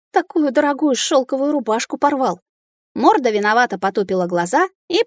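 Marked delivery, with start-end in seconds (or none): 0.1-2.4 higher-pitched grumbled complaint; grumbling. A narrator's reading. Heroine to the dragon that tore her shirt, grumbling. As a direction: grumble in indignant reproach. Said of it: "проворчала я".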